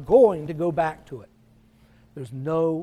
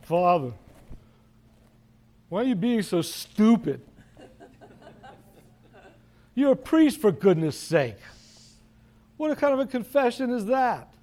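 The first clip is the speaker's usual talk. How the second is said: a hint of a stern confessor scolding a foolish priest; gruff scolding; 4 semitones higher than usual. Old confessor scolds the penitent priest: mock gruff rebuke. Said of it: "in that gruff voice of his".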